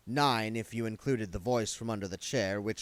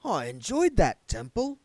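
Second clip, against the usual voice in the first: wistful; 11 semitones higher, 7 dB louder than usual. A narrator's reading. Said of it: "said Arthur wistfully".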